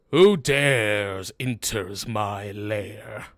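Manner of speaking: slow, drawn-out booming challenge. Acted. Game master as hidden captain challenging intruders, slow booming voice. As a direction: boom it out slowly, like a lair's master. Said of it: "You hear a booming voice".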